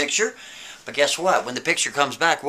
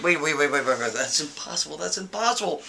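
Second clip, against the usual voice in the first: stunned murmur; 6 dB quieter than usual. Retold or imitated. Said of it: "He's like".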